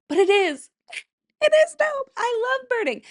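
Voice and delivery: very high, gushing squeal of joy; squealing excitement. Real, not acted. Vlogger gushing to viewers that birding is dope, high squeal. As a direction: squeal it like a proud bird nerd.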